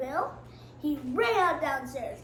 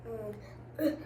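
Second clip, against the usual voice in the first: usual speaking voice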